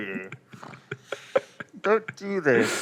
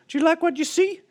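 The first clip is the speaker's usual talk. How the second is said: much higher, flirty woman; high woman's voice; 14 semitones higher, faster than usual. Acted. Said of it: "and says".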